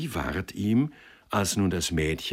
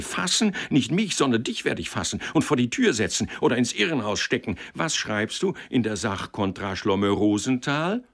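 higher, mocking tirade; angry ranting; 3 semitones higher than usual. Lawyer mocking the clerk's botched petition, angry. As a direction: read his blunder back in scorn. Boss scolding clerk's error.

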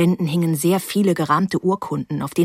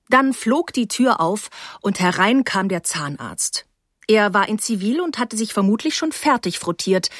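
ordinary delivery

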